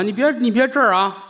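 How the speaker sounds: a hint of nagging nuns, pitched high; scolding nuns. Nuns scolding the vegetable seller off their doorstep, high and fussy. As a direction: shoo him off, high and fussy. Nuns shooing him away.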